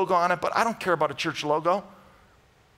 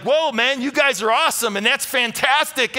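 a hint of a cheering, praising voice; light cheer, like applauding from the corner; mock cheering Jesus; 8 semitones higher, 6 dB louder than usual. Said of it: "Jesus clapping and praising them".

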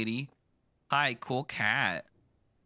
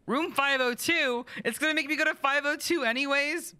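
very high, louder, whiny protest; squeaky annoyed; 4 dB louder than usual. Acted. Sent to another room, whining up high at the game.

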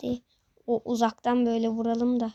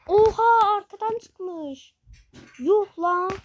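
sudden loud startled cry, much higher; surprised; 8 semitones higher, 5 dB louder, slower than usual. Real, not acted. Streamer startled by enemies appearing behind, loud surprised cry. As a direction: blurt it out in shock.

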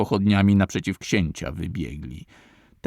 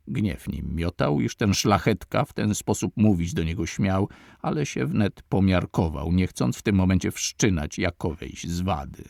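plain reading voice

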